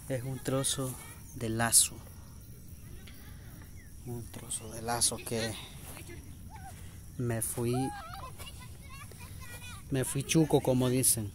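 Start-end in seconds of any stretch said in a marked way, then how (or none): none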